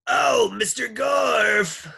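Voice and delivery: high, loud, long-held singsong; singsong call. A narrator's reading. Lunch lady calls at the classroom door, loud singsong. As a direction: sing out the name in a shrill-pitched holler. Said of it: "sang Miss Mush".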